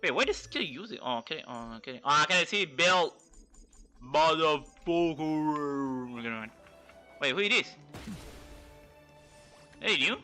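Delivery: ordinary delivery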